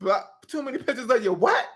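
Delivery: mock-shocked under the breath; shook. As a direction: repeat it softly, rising into a stunned question. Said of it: "I was so shook".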